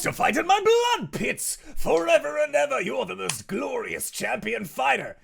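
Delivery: building to high, loud roar; grandiose demon. Acted. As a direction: boast of eternal blood pits. Rakdos grand speech.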